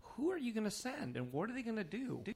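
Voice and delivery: baffled questioning of the caller; incredulous. Retold or imitated. Retelling own reply to caller.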